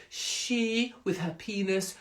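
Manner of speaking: ordinary delivery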